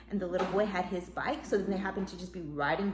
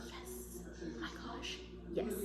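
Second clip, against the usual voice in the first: excited whisper; 14 dB quieter, slower than usual. Retold or imitated. Thrilled at spotting the nanny.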